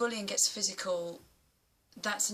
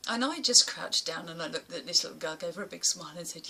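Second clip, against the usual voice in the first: ordinary talk, nothing marked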